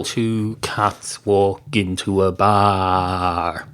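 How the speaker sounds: drawn-out, deliberate joke set-up; slow joke opening. Acted. Comedian opens a bar joke for listeners, slow and deliberate.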